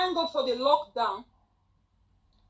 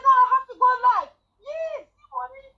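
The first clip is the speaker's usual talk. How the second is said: high, fast, loud resolve; excited; 10 semitones higher, 6 dB louder, faster than usual. Retold or imitated. Deciding to go live at midnight, fast high excited. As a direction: blurt it out in a rush. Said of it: "I said".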